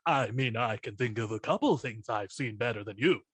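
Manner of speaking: a dry comeback as George Takei; George Takei reply. Shown as a demonstration. Lightly voiced George Takei answering Shatner. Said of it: "George Takei".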